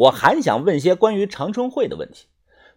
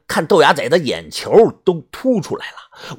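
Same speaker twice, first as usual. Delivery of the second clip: usual speaking voice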